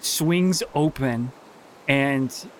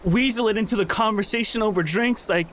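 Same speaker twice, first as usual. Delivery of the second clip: much higher, mocking then breaking; anguished bitter confession; 9 semitones higher than usual. Lizzie throwing her secret guilt at Jay, anguished and high. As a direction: spit out the confession she never made.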